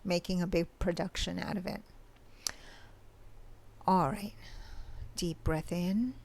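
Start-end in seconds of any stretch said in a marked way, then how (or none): 3.9-6.1 guided meditation. Acted. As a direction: guide the listener gently into the present moment. Leads a mini meditation.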